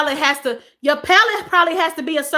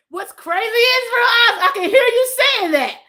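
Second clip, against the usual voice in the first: tickled squeal; 9 semitones higher than usual. Real, not acted. Amused reading of viewer's comment.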